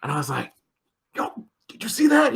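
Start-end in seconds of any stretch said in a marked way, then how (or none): 1.1-2.3 excited. Retold or imitated. Excited retelling of spotting star.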